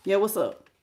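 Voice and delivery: brief, flat, no interest at all; dismissive reply. Shown as a demonstration. Reader demonstrating a cold reply to a reaching-out ex, flat. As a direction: say it like you're already done with them. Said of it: "Notice how I just said it".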